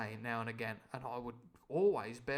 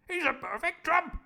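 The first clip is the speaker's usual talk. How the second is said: the Squire declaring; 8 dB louder than usual. Acted. The Squire praising Long John, high and booming. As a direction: proclaim it like a booming toff. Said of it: "the Squire does a lot of perfect trumps".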